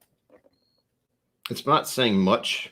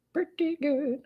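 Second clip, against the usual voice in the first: odd put-on voice; 3 dB quieter, slower than usual. Echoing own words in odd voice.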